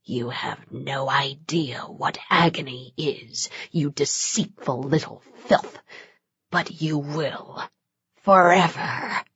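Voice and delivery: barely audible demon whisper; low menacing whisper. A narrator's reading. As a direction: breathe it so low she barely hears. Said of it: "something so low Denise could barely hear him".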